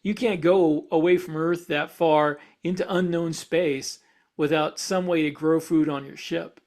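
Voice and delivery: ordinary delivery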